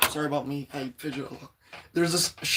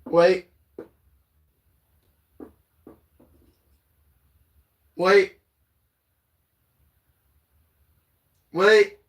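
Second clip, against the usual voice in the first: louder, higher rising waits; excited wait; 3 semitones higher, 4 dB louder, much slower than usual. Real, not acted. Streamer making her viewers hold on, louder rising waits. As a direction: hold everyone in suspense, pitching up.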